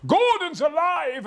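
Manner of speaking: huge, high, drawn-out bellow; triumphant full-voiced shout; booming catchphrase. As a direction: roar it out, stretching every word. Booms out famous catchphrase.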